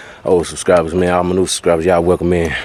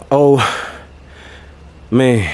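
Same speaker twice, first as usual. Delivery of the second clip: winded sigh of relief; heavy breath between words; 4 semitones higher than usual. Real, not acted. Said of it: "man, I'm out of breath".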